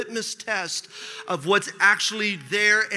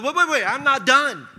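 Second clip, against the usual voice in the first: raised-pitch objection to being cut off; passionate protest; 4 semitones higher, 4 dB louder than usual. Retold or imitated. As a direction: re-enact a heated refusal to stop. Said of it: "got a little bit of passion".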